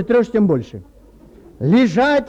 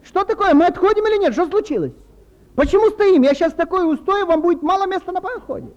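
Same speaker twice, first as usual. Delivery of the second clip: shouting; 8 semitones higher than usual. Pretend. Angry passenger yells at captain.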